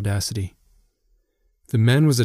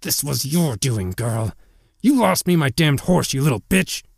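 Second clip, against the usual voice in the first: raised, vicious accusation; spiteful snarl, pitched up; angry snarl; 5 semitones higher than usual. A narrator's reading. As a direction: snarl it at someone you blame. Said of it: "he snarled".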